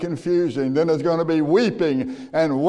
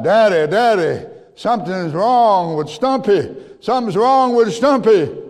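put-on higher, louder alarmed child's call; frightened child calling; 8 semitones higher, 5 dB louder than usual. Little boy running inside to his father about his sick dog, loud alarmed call. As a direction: cry out for Daddy in a panic.

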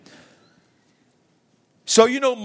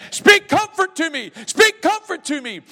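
much higher, louder begging; imitated pleading; 12 semitones higher, 5 dB louder than usual. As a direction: cry out for comfort. Sinner pleading voiced.

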